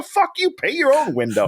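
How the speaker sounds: high-pitched, indignant retort, no full shout; mock angry comeback. Firing back at someone screaming about a window, mock angry. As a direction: fire back in mock outrage. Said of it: "you're screaming at me".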